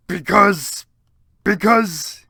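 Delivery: loud, drawn-out hesitation; milk the suspense; dramatic because. Acted. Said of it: "paused in the air dramatically".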